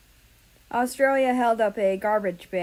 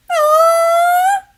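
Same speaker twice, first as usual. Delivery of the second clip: very high loud delighted squeal; excited squeal; 15 dB louder than usual. Acted. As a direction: squeal at the cute cat. Japan squeals at cat.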